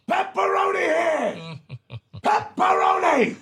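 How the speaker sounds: high shouted name across the bar; calling out. Retold or imitated. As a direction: yell it to the whole room. Bar owner calls out the name.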